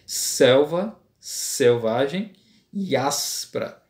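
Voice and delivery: very slow, every S in "selva selvagem e áspera" pressed; stressing the S sounds. Shown as a demonstration. Teacher reading the verse aloud to show alliteration, very slow. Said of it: "o S".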